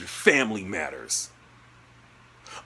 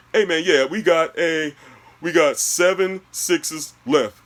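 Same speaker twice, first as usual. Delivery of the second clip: lightly voiced clerk, pitched higher; slow the announcement, a touch higher; imitated store clerk; 5 semitones higher, 4 dB louder than usual. Store worker reporting stock to customer, lightly imitated clerk.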